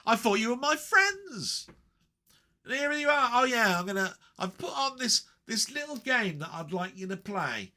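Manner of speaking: high sing-song imitation of viewers; mock wounded friend. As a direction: play the betrayed friend. Mock hurt then imitates viewers.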